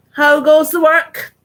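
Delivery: loud slow bossy call; farmer calling out. Acted. Lazy farmer calling from his bed, loud and slow. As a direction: bellow it out like a lazy boss.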